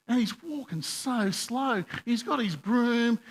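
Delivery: slow, high, drawn-out words; exasperated slow drawl. Retold or imitated. Venting to the congregation about the slow shop worker, exasperated. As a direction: stretch it out to show a maddening dawdle. Mimics the man's slow walking.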